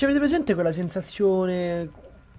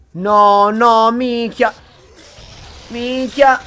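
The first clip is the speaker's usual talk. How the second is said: frustrated outburst; 5 semitones higher, 9 dB louder, much slower than usual. Real, not acted.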